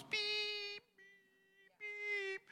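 very high thin beep tones; imitated beeps. Hearing test machine beeping at the pastor.

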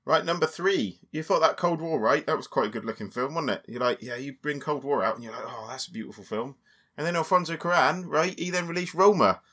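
mock Somerset yokel talk for a bit; Somerset accent. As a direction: put on a comic Somerset accent. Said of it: "to do this bit in that accent".

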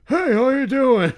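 slow, high, blaring foghorn holler; loud drunk heckler. Retold or imitated. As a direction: imitate a loud, gruff drunk woman. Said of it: "She sounded like a foghorn".

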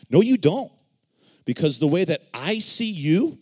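loud, high, emphatic contradiction; firm rebuttal. Acted. Preacher contradicting someone who labels themselves negatively, emphatic. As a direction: cut off the self-criticism forcefully.

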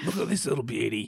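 lightly put-on nature documentary presenter; wildlife explorer voice. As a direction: marvel at the animal like a wildlife host. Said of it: "wildlife explorer voice".

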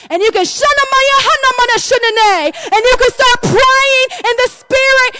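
high, driving preacher delivery; fervent preaching. Real, not acted. Preacher urging the congregation to pray in the Spirit, fervent. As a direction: preach it with full fervour.

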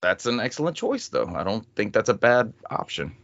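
normal talking voice

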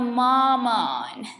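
long sulky mamaaan; whiny drawn-out mamaaan. Acted. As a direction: stretch mamaaan slowly, whining. Drawn-out whine at mother.

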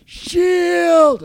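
high yelled Shield; shouted battle cry. Retold or imitated. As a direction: shout it like a warrior. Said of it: "when she goes".

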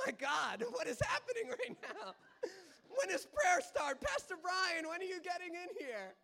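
high, loud, panicked shouting; hammed-up cry for rescue; mock panic. Acts panicked plea for rescue.

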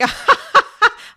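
high loud giggle; shrieking laugh; giggling burst. Acted. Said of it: "couldn't stop laughing".

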